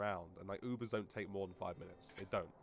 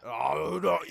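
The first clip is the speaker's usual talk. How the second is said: mock panicked backpedal; 7 semitones higher, 13 dB louder than usual. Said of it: "backpedals jokingly".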